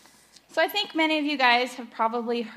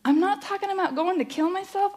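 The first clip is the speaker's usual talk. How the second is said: ordinary talk, nothing marked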